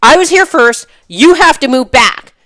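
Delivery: mock yell, high and loud; retelling a rude driver's high, loud demand; imitating a shout. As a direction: imitate his pushy yell. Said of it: "He's like".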